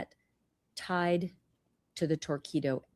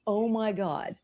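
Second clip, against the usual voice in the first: ordinary talk, nothing marked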